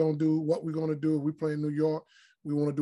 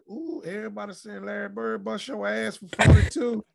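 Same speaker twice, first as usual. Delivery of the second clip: lightly voiced teasing mom, higher; a hint of mom ribbing him; 6 semitones higher, 6 dB louder than usual. Mom teasing her son about Bird's forty-two, high and playful. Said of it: "my mom was like".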